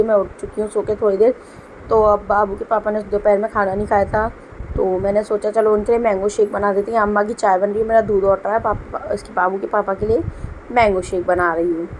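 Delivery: normal talking voice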